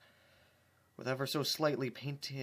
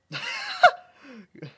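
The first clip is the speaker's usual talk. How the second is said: high laugh; 11 dB louder than usual. Real, not acted. Reader laughs at own voice.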